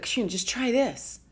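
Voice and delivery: urgent, insistent family pleading; imitating worried family nagging; pleading relatives. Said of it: "they were like".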